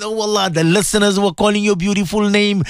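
sing-song supplication with long chanted ameens; swaying, chant-like congregational prayer; fervent prayer leading. Real, not acted. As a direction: lead listeners in heartfelt dua, stretching the holy words.

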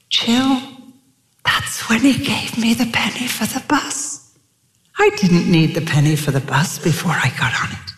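lightly imitated Dutch accent of a woman remembering; rolling Dutch accent. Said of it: "she had that beautiful rolling accent".

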